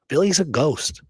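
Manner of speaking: normal talking voice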